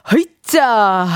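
drawn-out heave as if getting up; imitated effort grunt. Voicing a listener rising from a seat, mock effort cry. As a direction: play a little heave-ho exertion cry. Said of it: "저절로 입에서 뭔가 소리가 납니다".

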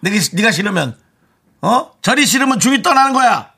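high-pitched mock lecture; acted scolding. Host voicing a listener's rebuke to a coworker, mock anger. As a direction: tell them off with indignation.

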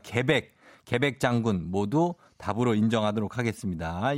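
ordinary talk, nothing marked

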